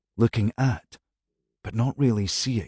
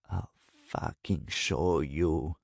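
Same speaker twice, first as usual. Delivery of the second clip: low, slow, softer growl; growled to herself; 4 semitones lower, 8 dB quieter, slower than usual. A narrator's reading. Woman alone after storming out, growling to herself. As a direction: grind it out under her breath. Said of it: "she growled to herself".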